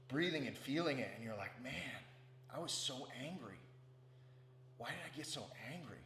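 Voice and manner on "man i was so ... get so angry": quiet self-reflection. Acted. Acted self-reflection during meditation.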